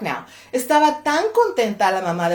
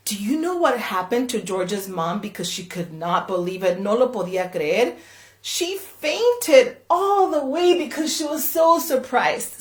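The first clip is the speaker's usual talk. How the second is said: ordinary delivery, nothing marked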